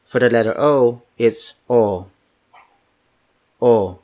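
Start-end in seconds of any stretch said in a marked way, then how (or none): 1.7-4.0 O as a pure rounded "oh". Shown as a demonstration. Teacher models the letter O for learners, rounded "oh". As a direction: round the O, no glide. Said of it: "So it's rounded".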